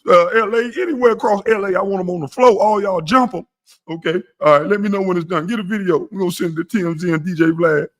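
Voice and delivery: high, put-on voice giving orders; mock whiny caller. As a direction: play the petty wannabe gangster on the phone. Acted caller ordering revenge.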